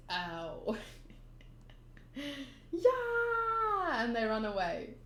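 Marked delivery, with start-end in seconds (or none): 0.1-0.8 low, softer deadpan ow; Zoro. Acted. Reader quoting the swordsman's reaction, low ow. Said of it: "that Zoro expression and just says". 2.7-4.0 long high scream; fleeing scream. Acted. Frightened pirates running away, screaming.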